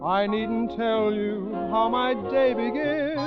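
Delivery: ordinary talk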